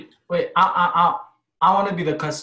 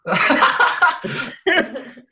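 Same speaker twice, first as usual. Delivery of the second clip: laughing; 9 semitones higher, much slower than usual. Real, not acted. Laughs at his own smile take.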